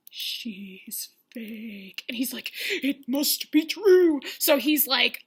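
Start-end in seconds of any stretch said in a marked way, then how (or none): 0.1-2.0 low, very soft whispery drawl; mock ominous verdict. Victoria answering Peter's question, mock ominous. 2.7-4.2 loud, very slow proclamation; mock gullible conviction. Peter accepting the gossip, mock gullible.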